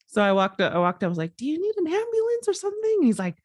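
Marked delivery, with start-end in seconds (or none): 1.4-3.0 very high, quick question; concerned calling out. Retold or imitated. Passerby offering help to screaming man, high concerned call. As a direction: re-enact checking on a stranger, high and hurried.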